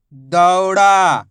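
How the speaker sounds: दौड़ा as one drawn-out word; syllables joined. Shown as a demonstration. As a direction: blend दौ and ड़ा without a pause. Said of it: "उच्चारण करके आपस में लाके".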